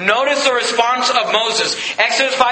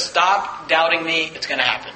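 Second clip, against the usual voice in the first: firm God voice; 5 semitones lower, 4 dB quieter than usual. Acted. God rebuking Abraham's doubt.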